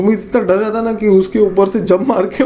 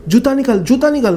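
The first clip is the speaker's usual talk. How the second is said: urgent urging; 6 semitones higher than usual. Retold or imitated. Panicked friend urging.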